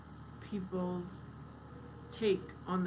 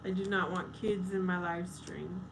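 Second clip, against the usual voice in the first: ordinary delivery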